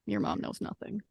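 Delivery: put-on a low sly aside to the kid; conspiratorial. Mel sides with Belly against mom, low and sly.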